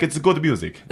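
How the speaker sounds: hammed-up Italian accent; mock Italian accent. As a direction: toss it off like an Italian fan. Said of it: "That was more Italian".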